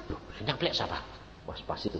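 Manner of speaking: soft, breathy wondering; hushed puzzled. Retold or imitated. Preacher to church, hushed puzzlement. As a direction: drop to a hush, puzzled.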